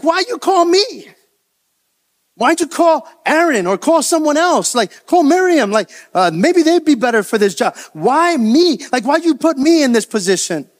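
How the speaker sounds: high-pitched, aggrieved pleading; displeased protest. Acted. Moses asks God why he was chosen, displeased protest. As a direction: protest up high, as if unfairly picked. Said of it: "Moses was displeased".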